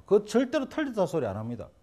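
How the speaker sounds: ordinary talk, nothing marked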